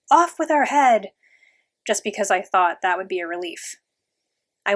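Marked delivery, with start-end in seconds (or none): 0.1-1.1 high, loud, drawn-out royal command; imperious storybook shout; yelling queen. A narrator's reading. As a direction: yell it slowly like the Queen of Hearts. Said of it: "always yelling".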